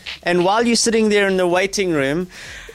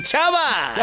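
mock posh ciao; 5 semitones higher than usual. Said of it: "Okay, ciao".

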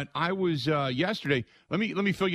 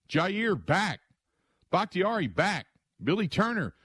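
usual speaking voice

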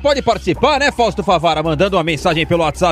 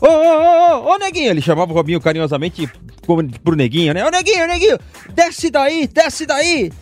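calling out; 6 semitones higher than usual. Retold or imitated. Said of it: "Aí o Leão tava falando".

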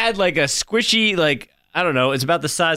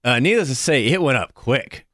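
ordinary talk, nothing marked

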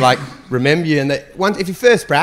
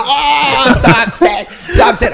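high mocking whine, rising steeply on so upset; mock whining toddler; 10 semitones higher than usual. The comic mocking an upset audience member as a whining toddler. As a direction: sneer at him like a sulking toddler. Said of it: "acting like my four-year-old toddler".

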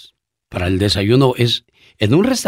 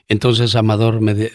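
usual speaking voice

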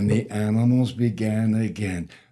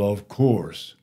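ordinary delivery

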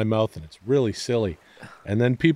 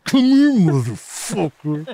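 slow, louder higher mock threat; mock tough guy; 7 semitones higher, 5 dB louder, slower than usual. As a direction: drag out the threat, hammed up. Imagined reply at the subway.

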